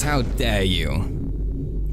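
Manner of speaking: very slow, dragged-out hammed-up indignation; mock outrage. Streamer dramatically scolds chat, dragging every word. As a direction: drag it out like a theatrical villain. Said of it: "I am angry".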